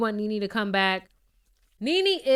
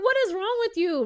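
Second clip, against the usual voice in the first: exasperated Kenya; 10 semitones higher than usual. Retold or imitated. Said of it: "And Kenya's like".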